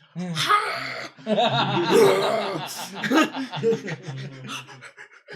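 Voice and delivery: loud, high mimicked dragon roar; big roar from burning hot food. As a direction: roar like a fire-breathing dragon. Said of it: "mimics a dragon roar".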